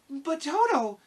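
put-on very high, worried girl's voice; anxious. A narrator's reading. Dorothy worries about her dog, high anxious girl's voice. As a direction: pitch it right up, loud with worry. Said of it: "said the girl anxiously".

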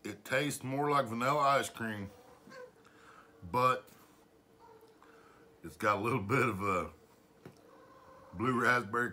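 slightly full mouth; speaking between spoonfuls; talking while eating. Real, not acted. Seen 0: Holds spoon of ice cream at mouth, eating while talking.

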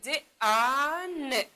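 د، ا، ن as separate sounds, ا stretched long; slow letter sounds د، ا، ن for a class; sounding out. Shown as a demonstration. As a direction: stretch the vowel in د، ا، ن. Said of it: "در صدا کشی خوب دقیق باشین".

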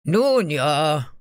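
loud guards' grudging assent; calling out. A narrator's reading. Guards agree to hear her, calling out. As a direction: call out agreement loudly. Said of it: "riefen sie".

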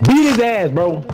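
high, hyped yell; excited cheer. Real, not acted. Cheering a teammate's kill.

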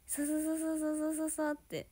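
quick sa-sa-sa cockroach scuttle; scurrying sound. Retold or imitated. Imitating a cockroach darting across the floor. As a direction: rattle off sa-sa-sa like skittering legs.

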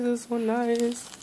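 quiet, slow echo to herself; soft murmur. Real, not acted. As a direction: repeat it softly, almost aside.

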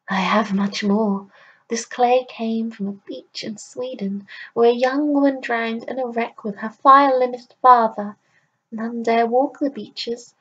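put-on an old market crone weaving a warning legend; old crone telling a tale. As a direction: crone selling cursed clay with a dark warning. Crone tells the clay's tale.

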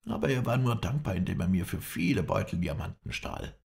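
usual speaking voice

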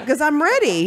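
a hint of an impatient wife; wife ready to leave the store, lightly voiced. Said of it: "she's like".

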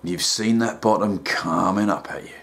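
a deep, calm therapist talking down; deep soothing counsellor; rehab counsellor. Acted.

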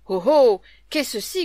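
loud angry oh, oh; jealous husband roars in the night; growling outburst. A narrator's reading. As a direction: roar it like a woken lord. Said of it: "gronda Hugues".

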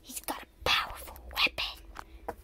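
soft whispered aside. Acted. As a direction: hush it to the others. Toy character whispers warning.

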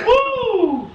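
loud high whoop with a laugh; excited whoop. Real, not acted. Reacting to burning cinnamon snort.

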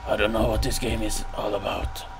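hushed, uneasy near-whisper; softly whispered unease; nervous whisper. Real, not acted. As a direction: keep it under your breath, uneasy. Nervous start of horror game.

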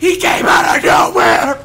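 high, loud, slow scream of outrage; outraged scream. Real, not acted. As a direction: scream it like you got robbed. Just died in game.

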